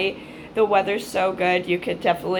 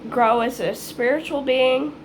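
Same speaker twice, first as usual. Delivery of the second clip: usual speaking voice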